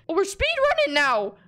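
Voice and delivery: ordinary talk, nothing marked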